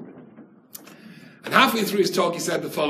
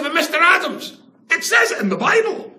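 much higher and louder, mock-innocent nerd; squeaky teen nerd protesting; silly nerdish voice; 13 semitones higher, 7 dB louder than usual. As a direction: push the naive nerd voice up further, all faux outrage. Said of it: "I dropped the silly voice".

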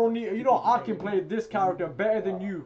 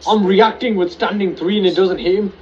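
loud furious ranting; rage-yelling at the game; raging gamer; 15 dB louder than usual. Real, not acted.

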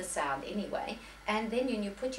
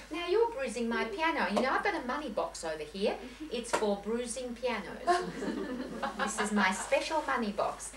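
higher-pitched, hammed-up complaint to a child; playful mock scolding; 6 semitones higher than usual. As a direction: tease a young pupil with pretend indignation. Playfully scolding a young pupil.

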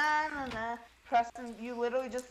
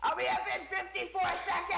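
laughing mock; 6 semitones higher than usual. Mocking, laughing.

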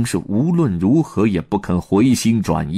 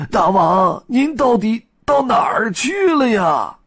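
high monkey voice, complaining and near tears; high monkey minister, tearful lament; 11 semitones higher than usual. Acted. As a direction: pour out their suffering to the king.

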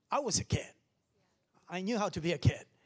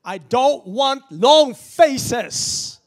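loud, slow, higher declaration; slow shout; 7 semitones higher, 17 dB louder, much slower than usual. Real, not acted. Preacher shouting slowly at the congregation. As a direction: drag it out, shouting.

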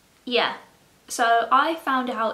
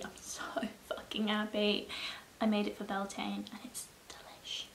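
whispering; 9 dB quieter than usual. Real, not acted. Whispering about cookie while eating.

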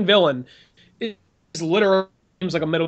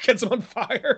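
ordinary talk, nothing marked

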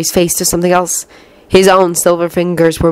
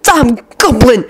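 slow, higher, strained annoyance; annoyed hiss; 11 semitones higher, slower than usual. A narrator's reading. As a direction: hiss it slowly, worry underneath. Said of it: "Lucius hissed".

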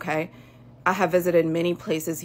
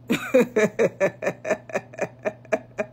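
mocking laugh; 4 semitones higher than usual. Real, not acted. Scoffing laugh at rivals.

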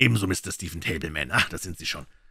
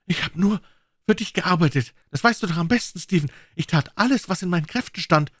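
much higher, louder pleading voice; pleading Munsey; 12 semitones higher, 4 dB louder than usual. A narrator's reading. Munsey pleading with his cousin Stephen, high and desperate. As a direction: beg him to believe you.

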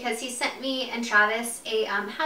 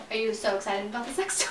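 ordinary delivery

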